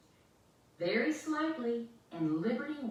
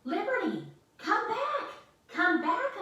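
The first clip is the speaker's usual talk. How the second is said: high, loud desperate call; yelling; 14 semitones higher, 5 dB louder than usual. Acted. Girl calling her escaping dog, loud desperate yell. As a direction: shout after the runaway dog. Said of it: "America yelled".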